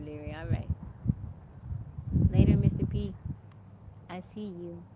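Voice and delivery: ordinary talk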